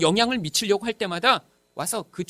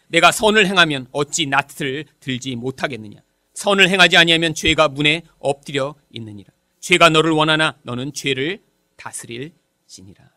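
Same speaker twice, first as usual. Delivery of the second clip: scripture reading; 4 semitones lower, 3 dB louder than usual. Acted. Preacher reads God's words to Cain to the congregation, solemn scripture reading. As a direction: solemnly intone the verse, phrase by phrase. Reads the Bible verse aloud.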